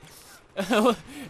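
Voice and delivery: high, loud burst of laughter. Real, not acted. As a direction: crack up out loud. Laughing at chaotic match.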